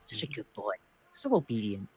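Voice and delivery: soft, tender praise for a pet. Acted. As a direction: coo it like praising a dog. Praising a dog-like companion.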